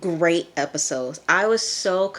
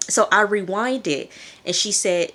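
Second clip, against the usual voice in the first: ordinary delivery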